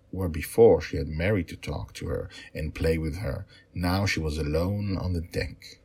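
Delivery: steady storytelling voice for a bedtime tale; story narration. A narrator's reading. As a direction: read it like a father telling his girls a story. Father reads his story aloud.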